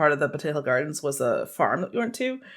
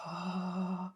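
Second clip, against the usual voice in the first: soft, slow, yearning murmur; quietly longing to touch the dogs; longing hush; 3 semitones higher, 8 dB quieter, much slower than usual. Retold or imitated. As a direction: sigh it out like you're aching to pet them. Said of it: "And I was like".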